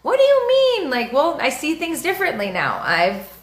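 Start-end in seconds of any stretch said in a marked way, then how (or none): 0.0-0.9 mocking shocked people. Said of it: "people do not like it whenever you change".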